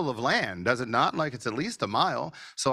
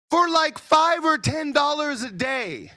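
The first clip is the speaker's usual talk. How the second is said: much higher and louder, slowed right down; excited shout; 14 semitones higher, 6 dB louder, slower than usual. Real, not acted. Pitching cheap local ads to the audience, excited shout. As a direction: shout it out like the big reveal.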